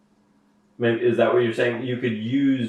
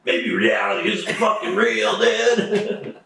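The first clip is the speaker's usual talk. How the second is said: stoner voice; 8 semitones higher, slower than usual. Retold or imitated. Mocking deep stoner musing.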